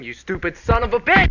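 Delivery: very loud, high yell; enraged shout at a bandmate; furious outburst. Acted. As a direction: explode into a screamed insult. Acted outburst at being introduced.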